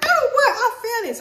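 shrieking high and loud in shock; shocked cry. Retold or imitated. Reading the dean's text, a high, loud shocked shriek. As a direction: yelp it in disbelief. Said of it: "So I was crying".

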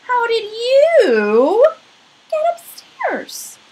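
a watchman startled to find a bear; surprised watchman. Acted. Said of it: "he said".

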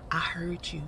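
whispered I heard you. Retold or imitated. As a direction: hiss it under your breath to him. Calling out man walking past.

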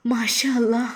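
hushed thanks to God; awed whisper. Acted. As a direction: breathe it in wonder. Seeing the positive test. (അത്ഭുതത്തോടെ മന്ത്രിക്കുന്നു)

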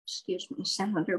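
breathless whispered interjection, barely voiced; startled whisper to the senator; taken-aback whisper. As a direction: hush it out, shocked into a whisper.

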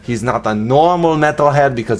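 amateur Austrian-accent impression of an action star; Arnold Schwarzenegger accent. Vlogger redoing a silent unboxing for viewers, mock Austrian accent. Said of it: "in an Arnold Schwarzenegger accent".

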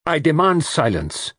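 high, forceful demand; crying out. A narrator's reading. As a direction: cry out for order. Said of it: "cried Poirot".

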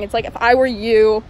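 muffled behind surgical mask; speaking while walking masked; through a face mask. Real, not acted. Seen 3: Surgical mask covers mouth and nose throughout; reference frames unmasked.

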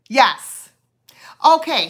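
usual speaking voice

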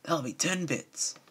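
high, surprised shopkeeper voice; baker's greeting. Acted. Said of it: "Pony Joe replied".

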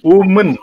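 "Woman" loud and crisp, ending in a short mun; schwa pronunciation. Shown as a demonstration. Trainer demonstrates the singular to teachers, loud schwa pronunciation. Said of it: "it is schwa sound there".

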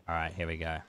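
usual speaking voice

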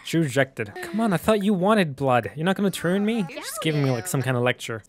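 usual speaking voice

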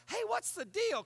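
amazed asking. Retold or imitated. People asking God's blessing.

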